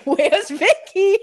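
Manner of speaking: high, slow, drawn-out call across the crowd. Retold or imitated. The townsfolk calling out for Vicky, high and drawn-out. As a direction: call out searching for someone lost. Whole town searching from roof.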